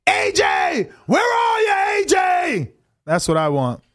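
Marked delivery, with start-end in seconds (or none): 0.1-2.7 high, taunting shout imitating a fighter; mock call-out. Fan re-enacting a boxer's old call-out of a rival, high taunting shout. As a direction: holler for a rival, stretching the name. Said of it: "say what he said a couple years ago".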